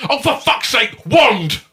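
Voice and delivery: high, loud exasperated yell; posh outburst. Retold or imitated. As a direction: blurt it out, fed up and shrill with rage. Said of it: "he just went".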